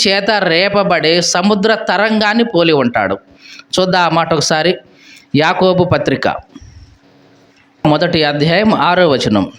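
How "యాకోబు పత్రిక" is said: normal talking voice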